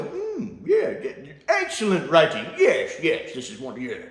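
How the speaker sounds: high smug hum of approval, laughing through it; mock approving fan. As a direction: play a fan pretending it's genius. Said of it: "watches him open his eyes and go".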